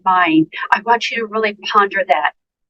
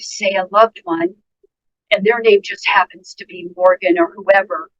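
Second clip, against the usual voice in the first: ordinary delivery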